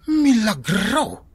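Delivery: high, slow, stretched cry of amazement; amazed exclamation. Acted. Husband marvels that his wife is being kind, drawn out and high. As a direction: marvel loudly at a miracle.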